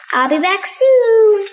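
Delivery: cheery Granny Pig. Acted. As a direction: call back cheerfully as she leaves. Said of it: "says Granny Pig".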